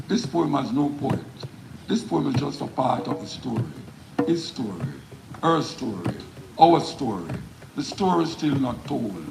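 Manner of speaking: emphatic spoken-word delivery, phrases falling at the ends; poem recitation. Acted. Poet performing the poem live for an audience, declaimed. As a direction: declaim each line with weight, pausing between phrases.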